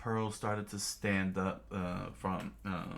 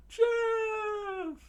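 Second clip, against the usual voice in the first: very high, loud cry of chef; alarmed shout; 6 dB louder than usual. Acted. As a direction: call out in alarm. Crew cries out to chef.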